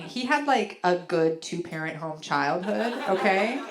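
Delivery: ordinary delivery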